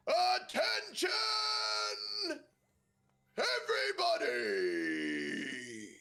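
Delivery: high, slow calling shout; stage announcement. Acted. Corgi on stage shouts for the crowd's attention, loud and slow. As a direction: call the whole room to attention.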